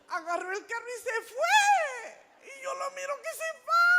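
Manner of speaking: high, loud cry of dismay, stretched at the end; hammed-up, shouted disbelief; mock panicked wail. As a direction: wail it out as you watch them leave. Acting out panic as husband drives away.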